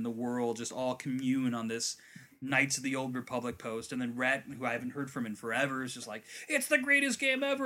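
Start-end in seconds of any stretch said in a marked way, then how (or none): 6.5-7.6 high-pitched imitated fan excitement; gushing fan. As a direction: gush like an over-excited game nerd. Acting out friend's gushing post.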